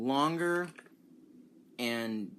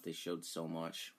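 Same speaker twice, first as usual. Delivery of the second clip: normal talking voice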